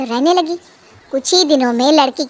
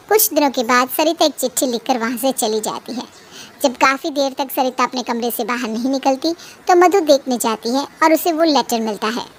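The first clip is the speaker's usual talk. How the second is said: ordinary talk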